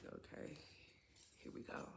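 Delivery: breathy whispered okay, here we go; whispered aside. Retold or imitated. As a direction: say it under your breath, resigned. Aside while he keeps leaving.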